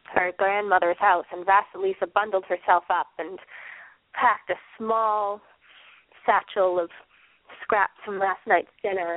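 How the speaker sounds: ordinary delivery, nothing marked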